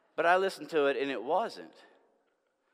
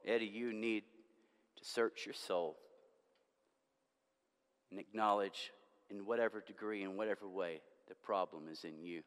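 quiet self-address; 7 semitones lower, 12 dB quieter than usual. Real, not acted. Preacher addresses his own soul.

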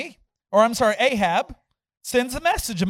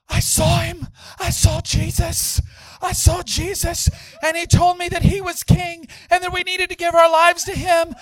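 high, overwrought cries; frantic testifying; 9 semitones higher, 5 dB louder than usual. Retold or imitated. Converted leader to his puzzled group, high frantic testimony. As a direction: imitate a man crying out what he just saw.

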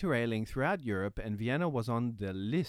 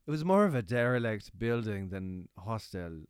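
lightly put-on British accent reading a review; a hint of a British accent; 2 semitones lower than usual. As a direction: read it as a British tourist would. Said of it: "Let's say this guy was, uh, British".

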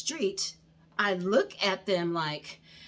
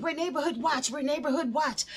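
retold yell; 7 semitones higher than usual. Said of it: "she's yelling and screaming".

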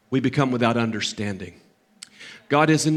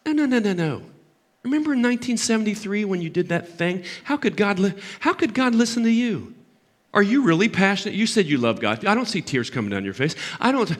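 lightly acted accuser, mostly pitched up; accusing serpent; 7 semitones higher than usual. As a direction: nag and cast doubt, as the serpent would. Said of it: "Have you ever heard that serpent talk?".

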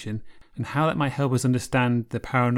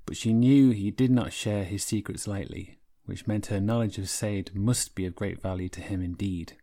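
calm, measured storytelling voice; epic fantasy narrator; 4 semitones lower than usual. A narrator's reading. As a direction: tell it like an old Norse saga. Author reads from her novel.

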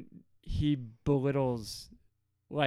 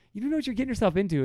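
fast, high, louder warning; mock exasperation; 8 semitones higher, 6 dB louder, much faster than usual. God warning Israel about new gods, exasperated and fast. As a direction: rattle it off in high-pitched disbelief. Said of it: "there's more of this".

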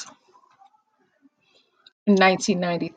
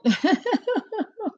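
high giggle; 12 semitones higher than usual. Real, not acted. Laughs at own joke.

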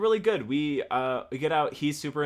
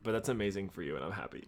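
deflated, trailing lower and softer; unconvincingly happy; 3 semitones lower, 5 dB quieter, faster than usual. Real, not acted. Jealous friend congratulating co-host on Coachella, half-hearted and subdued. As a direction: congratulate with obvious reluctance. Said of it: "you sound so happy for me".

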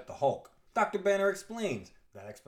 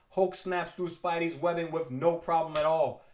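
ordinary talk, nothing marked

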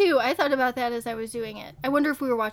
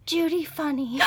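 lightly voiced dramatic artist sister; Judy Funny voice; 3 semitones higher than usual. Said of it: "I'm gonna say her name like she talks".

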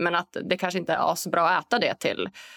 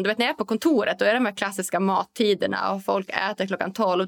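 ordinary talk

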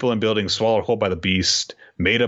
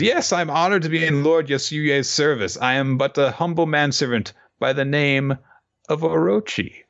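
high, sly simpering servant voice; mock humility hiding menace; humble Orochi; 8 semitones higher than usual. Orochi introduces himself humbly.

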